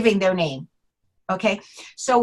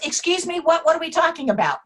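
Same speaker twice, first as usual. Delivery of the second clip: startled mom; 7 semitones higher, faster than usual. Retold or imitated. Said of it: "And so she's startled".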